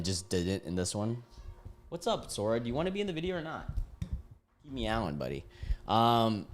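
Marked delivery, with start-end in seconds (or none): 1.9-5.4 talking to the cat. Real, not acted.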